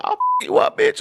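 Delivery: high, breathy mock threat; girl's threat. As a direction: squeal the threat way up. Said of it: "This one girl was like".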